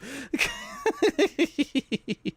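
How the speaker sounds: high, rapid run of giggles; long squeaky giggle; giggling. Real, not acted. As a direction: titter away in a high pitch. Laughs at own joke.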